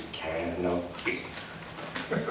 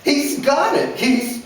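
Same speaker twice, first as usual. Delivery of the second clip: mother pleading; 4 dB louder than usual. A narrator's reading. Mother interrupted mid-defense.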